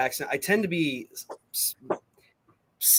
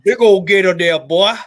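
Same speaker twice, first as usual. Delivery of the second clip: put-on Louisiana accent; 4 semitones higher, 11 dB louder than usual. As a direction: bellow it like a Cajun swamp man.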